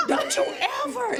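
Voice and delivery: scolding shout. Retold or imitated. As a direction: shout at the child who wandered off. Mother scolds found child.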